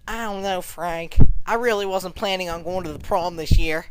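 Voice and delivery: hesitant girl's reluctance, lightly voiced; Edith. Edith turning down Frank, lightly voiced shy girl. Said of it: "she's like".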